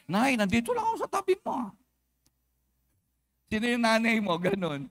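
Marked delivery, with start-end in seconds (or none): 0.1-1.7 put-on quick, higher whiny child reply; child answering back. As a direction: talk back like an annoyed kid. Child answering mother beside him. (mabilis na sagot ng anak)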